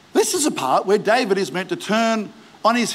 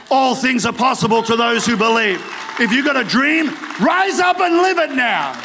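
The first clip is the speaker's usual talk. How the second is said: raised, ringing exhortation building in intensity; rally them to rise up, full of conviction; impassioned revival preacher; fired-up preaching; 6 semitones higher than usual. Acted.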